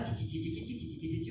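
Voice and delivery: high, soft ti-ti-ti chatter; imitated chit-chat. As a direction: mimic friends gossiping in a high, light babble. Imita conversinha entre as amigas. (imitando fofoquinha, agudo e baixinho)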